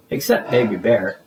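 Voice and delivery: plain reading voice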